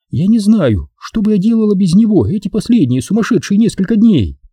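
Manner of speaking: high-pitched woman praising her friend; affectionate, high actress; Maxine, gushing gratitude. Acted. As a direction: gush fondly in a high female voice.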